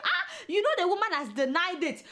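normal talking voice

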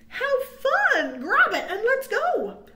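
high, loud and eager; cheery excitement. Acted. Character urging friend to grab the balloon, loud and eager. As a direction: cheer the find and hurry on.

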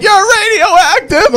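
very high, drawn-out shriek; horrified girl. Retold or imitated. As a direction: wail in falsetto mock horror. Girl horrified by glowing, voiced.

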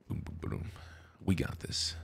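whispered pep talk; whispered hype. Acted. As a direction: whisper it like a secret. Hyping the team.

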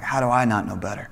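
soft, low musing to himself; hushed, rueful self-reproach; self-questioning. Acted. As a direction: ask yourself quietly, humbled. Preacher turns the question on himself.

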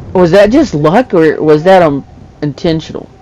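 ordinary delivery

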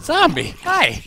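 excited taunt. Real, not acted. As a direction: hail the zombie like a long-lost friend. Greeting a zombie while fighting.

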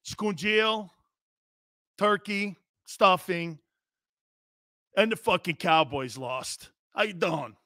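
put-on lightly done South Philly accent; a touch of Philly fan. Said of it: "going like this".

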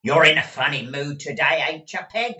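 high, loud, quick irritated remark; with poor grace. A narrator's reading. Gride needled by Peg's insult, testy. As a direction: snap testily at the servant. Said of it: "with not the best possible grace".

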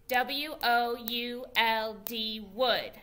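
teacher leading a spelling game with the class; slow game spelling. Shown as a demonstration. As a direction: chant the spelling slowly like a game count. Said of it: "we spell it, and then when we say the whole word".

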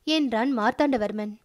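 ordinary delivery

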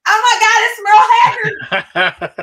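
high, loud, slow squeal with laughter; starstruck shriek. Retold or imitated. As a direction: squeal like a fan meeting her idol. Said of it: "threw myself up against the wall and went".

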